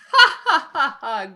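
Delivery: loud burst of laughter; hearty laugh. Acted. Adventurer laughs off being taken for pirates, loud laugh. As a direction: laugh off the guess, loudly.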